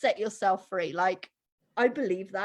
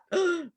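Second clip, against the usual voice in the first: a quick shocked intake of breath, pitched up; sharp gasp; 7 semitones higher than usual. Retold or imitated. Hearing what she told her mum, a sharp gasp. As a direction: gasp as if hearing something outrageous. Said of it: "I was like".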